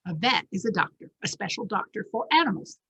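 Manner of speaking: ordinary delivery, nothing marked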